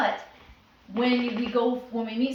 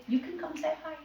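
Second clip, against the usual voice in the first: higher, gentle invitation; higher, inviting; 6 semitones higher, 3 dB quieter than usual. Shown as a demonstration. Owner inviting a visitor over, higher and gentle. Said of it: "you say".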